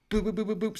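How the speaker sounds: quick high boops; high, repetitive boop sound effect; imitated switch noise. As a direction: mimic lights flicking on and off. Imitates lights being flipped on and off.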